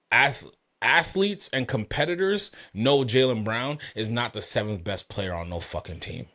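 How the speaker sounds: ordinary talk, nothing marked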